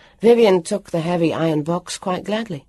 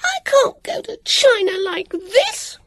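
high, loud, slow tearful scream; a loud high crying scream; 5 dB louder, slower than usual. A narrator's reading. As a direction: wail it out in outrage. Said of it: "Cousin Vivian screamed".

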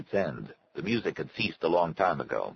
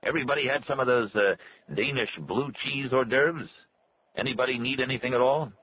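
higher-pitched host's call to guests; announcing; 8 semitones higher than usual. A narrator's reading. Host offers guests hors d'oeuvres, announcing. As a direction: pitch it up to ask the room. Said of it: "he announced".

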